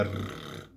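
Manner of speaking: "rrr" as a long, slow, trilled R; long rolled R. Shown as a demonstration. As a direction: stretch "rrr" out as a Russian R. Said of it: "o do russo é mais estendido".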